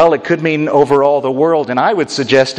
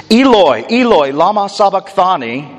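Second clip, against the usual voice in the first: loud cry; 6 semitones higher than usual. Retold or imitated. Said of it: "cried out in a loud voice".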